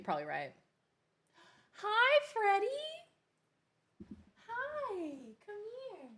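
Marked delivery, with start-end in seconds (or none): 1.7-6.1 pet-calling voice. Real, not acted. Greeting Freddie and calling them over, high pet-calling coo.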